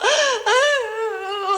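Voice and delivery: high, drawn-out mock sob; imitated crying. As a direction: gasp in and wail, imitating someone crying. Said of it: "울고 있는".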